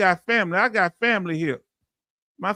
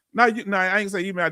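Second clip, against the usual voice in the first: ordinary talk, nothing marked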